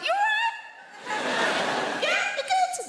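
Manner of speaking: squeaky high, mock-polite greeting. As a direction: pitch it way up, faking politeness. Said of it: "when our voices go high like that".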